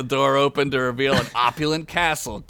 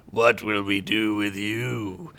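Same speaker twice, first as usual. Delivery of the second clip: dark lord; 4 semitones lower, slower than usual. Acted. Dark Lord threatens Boris.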